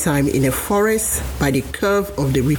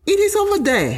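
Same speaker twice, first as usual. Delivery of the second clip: monkey pointing. Acted. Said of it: "Mel said".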